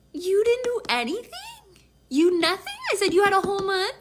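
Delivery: high, loud indignant questioning; incredulous. Retold or imitated. Woman confronting boyfriend over her birthday, incredulous.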